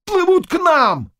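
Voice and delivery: high cry of horror; very high, alarmed yell; terrified shout. A narrator's reading. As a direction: shout in fright at what is coming. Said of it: "крик ужаса и испуга".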